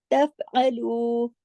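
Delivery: तफ़अलू drawn out with a still फ़; steady fa. Shown as a demonstration. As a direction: recite तफ़अलू slowly without echo. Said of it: "हिलाना नहीं है".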